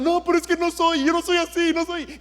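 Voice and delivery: mock defensive protest. Preacher mimicking a criticised churchgoer, mock defensive. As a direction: play the offended person insisting it isn't true.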